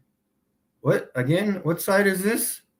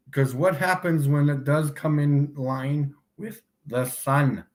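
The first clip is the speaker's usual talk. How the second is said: ordinary talk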